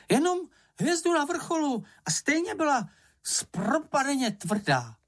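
high-pitched, indignant character voice; squeaky self-defence; firm protest. A narrator's reading. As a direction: object sharply in a small high voice. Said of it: "Ohradil se rázně Čenich".

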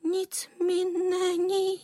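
put-on high, drawn-out boyish cry. A narrator's reading. Boy calling to the worried neighbours, crying out. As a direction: call it out high and stretch every word. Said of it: "Alan vykřikoval".

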